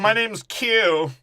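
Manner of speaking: hammed-up slow intro; mock self-introduction. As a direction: stretch each word out proudly. Voicing a character, slow.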